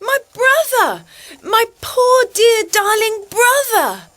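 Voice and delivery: crying out. Acted. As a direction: fake a tearful reunion loudly. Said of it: "Suddenly she cried out".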